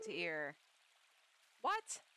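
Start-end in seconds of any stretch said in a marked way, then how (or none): 1.6-2.0 surprised. Real, not acted. Surprised reply to villager.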